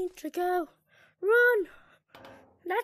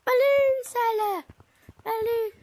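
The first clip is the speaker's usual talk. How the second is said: higher, louder call across the room; 7 semitones higher, 7 dB louder than usual. Real, not acted. Player calling the balloon seller over, loudly. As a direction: shout to get his attention.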